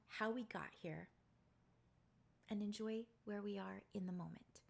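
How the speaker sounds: hushed, intimate confiding voice; soft-spoken. Real, not acted. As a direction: speak softly, close to the mic. Long soft reflective talk to viewers.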